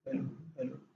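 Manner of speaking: whispered fading echo of hello. Retold or imitated. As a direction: let the hello die away like an echo. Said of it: "ऐसे सुनाई देता है".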